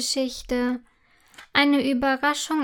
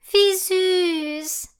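gushing cry of delight; delighted exclamation; 7 semitones higher than usual. A narrator's reading. Girl seeing the foal, delighted. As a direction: coo it out, lightly voiced. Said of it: "ruft Imme begeistert".

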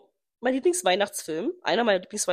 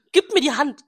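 louder, higher mock quote; imitating him; 4 semitones higher, 7 dB louder than usual. Repeating his line to her friend, she hams it up. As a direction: hams up his request, stressing mir. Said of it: "repeats his line".

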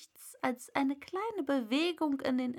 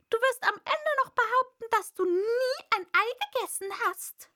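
high, loud bird voice, ranting; complaining pigeon; 13 semitones higher, 5 dB louder than usual. A narrator's reading. Pigeon ranting to Alice, high and loud. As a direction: rattle off the grievances, shrill bird.

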